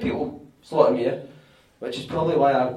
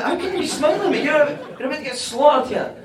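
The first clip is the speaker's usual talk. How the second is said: much higher, disbelieving and a bit louder; incredulous; 11 semitones higher, 5 dB louder than usual. Retold or imitated. Teammate mocks the grinning winger before the video clip, much higher and incredulous. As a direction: act amazed anyone could grin now.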